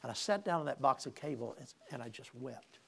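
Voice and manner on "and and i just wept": normal talking voice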